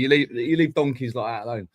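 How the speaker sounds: usual speaking voice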